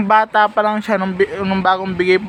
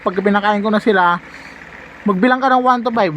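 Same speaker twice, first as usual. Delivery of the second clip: ordinary talk, nothing marked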